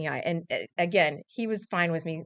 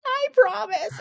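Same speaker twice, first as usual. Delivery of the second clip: small high-voiced promise; little girl promising her dad, high voiced; high child's promise; 13 semitones higher, 4 dB louder, slower than usual.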